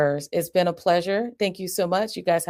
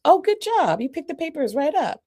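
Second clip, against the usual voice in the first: much higher, bright praise; high excited praise for picking up papers; big praise; 10 semitones higher than usual. Shown as a demonstration. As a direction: cheer the child on. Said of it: "the first two times".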